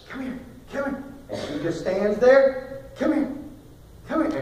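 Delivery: high, coaxing call to the lamb; father calling a stubborn lamb. Retold or imitated.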